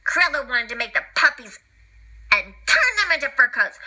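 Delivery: plain reading voice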